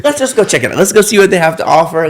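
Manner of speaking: excited. Retold or imitated. Voicing her adventurous attitude.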